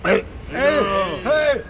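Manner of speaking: calling out. Acted. Hailing Rico after two days missing, calling out. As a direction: call the name out, stretching it.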